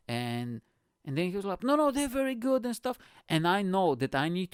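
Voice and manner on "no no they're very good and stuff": high-pitched imitation of a seller; pushy seller. Jeans seller reassuring a doubtful passenger, in a high mock voice. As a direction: put on a high, insistent sales voice.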